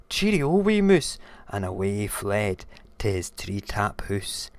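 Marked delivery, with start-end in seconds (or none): 0.1-1.2 high, loud goodbye; high-pitched alarmed owl; hasty frightened farewell. Acted. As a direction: call it out while fleeing.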